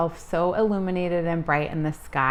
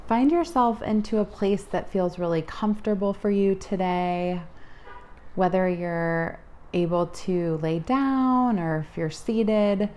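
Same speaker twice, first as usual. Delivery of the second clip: guided meditation. Acted.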